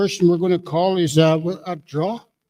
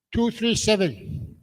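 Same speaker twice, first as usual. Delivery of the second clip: a quieter echo of the call; softer slow repeat; 4 semitones higher, 4 dB quieter, slower than usual. Real, not acted. Host repeating the ticket number for the room, softer and slow. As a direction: repeat it slowly, softer, still raised.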